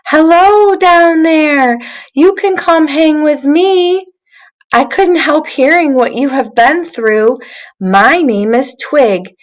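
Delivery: bright high voice of a kindly twig; friendly twig voice. Acted. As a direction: sing it down from the branches to a new friend. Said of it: "heard a voice from way up in a tree".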